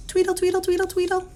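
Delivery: very high birdlike tweedling; little bird. Acted. Teacher voices pet store bird for children, high tweedling. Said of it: "little bird over here saying".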